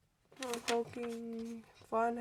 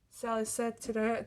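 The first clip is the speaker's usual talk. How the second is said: usual speaking voice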